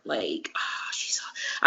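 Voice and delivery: soft, low whisper of fondness; whispered adoration; gushing whisper. Real, not acted. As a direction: hush it like a secret crush. Gushing about referee.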